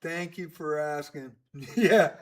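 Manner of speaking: normal talking voice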